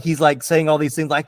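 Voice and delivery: usual speaking voice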